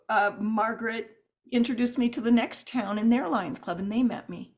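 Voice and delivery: ordinary talk, nothing marked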